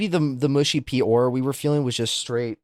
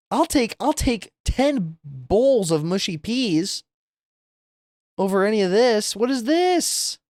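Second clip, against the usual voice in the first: exasperated; 10 semitones higher than usual. Real, not acted. Still disgusted, comparing foods.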